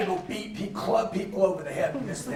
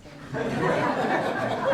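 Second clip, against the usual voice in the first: laugh; 5 semitones higher than usual. Real, not acted. Laughs at medication question.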